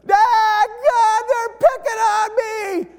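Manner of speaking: put-on high whining child's cry; whiny daughter. Little girl in the van whining to dad, high and tearful.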